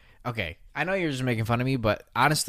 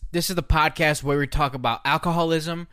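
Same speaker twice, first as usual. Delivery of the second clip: hammed-up higher host intro, slow and drawn out; mock podcast intro; 5 semitones higher, slower than usual. Guest takes over the intro to tease the host, hammed-up. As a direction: overdo the show opener as a joke. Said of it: "Let me try".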